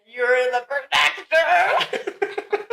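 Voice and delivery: very drawn-out mock protector, laughing; mocking put-on voice. Daughter teases father for his voice, drawn-out mocking imitation. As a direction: stretch the words in exaggerated imitation. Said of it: "Just, just that voice".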